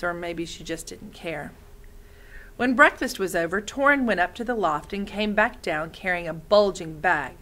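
ordinary delivery, nothing marked

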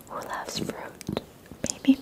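ordinary talk, nothing marked

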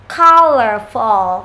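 "Colorful" slow and loud, dark L at the end; final L. Shown as a demonstration. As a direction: break "Colorful" down slowly for learners. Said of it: "Âm L cuối này".

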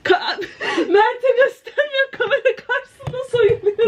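high giggling teasing; laughing tease. Real, not acted. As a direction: laugh while teasing her. (gülerek takılma)

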